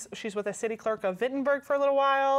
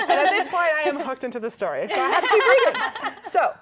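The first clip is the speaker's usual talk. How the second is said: laughing; 7 semitones higher, 7 dB louder than usual. Real, not acted. Laughing while telling story.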